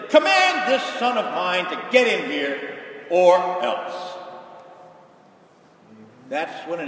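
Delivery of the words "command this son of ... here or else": slow, loud commanding order; a stern patriarch barking orders at servants. Retold or imitated. Ancient patriarch orders servants to fetch son, slow and commanding. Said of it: "he would send servants and say".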